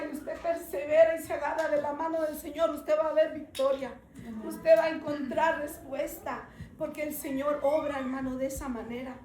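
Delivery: loud fervent preaching to the congregation; full preaching. Acted.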